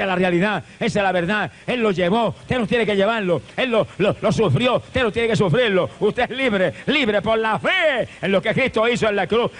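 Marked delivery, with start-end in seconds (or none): none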